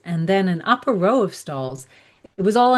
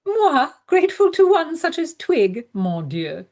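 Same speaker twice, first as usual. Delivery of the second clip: haughty scoff; 5 semitones higher than usual. Acted. Haughty French-flavoured scoff.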